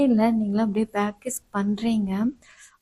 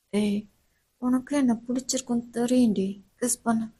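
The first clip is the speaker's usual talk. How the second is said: intimate professor; 6 dB quieter than usual. Acted. Professor murmuring to the student during the kiss. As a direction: lean in close and murmur.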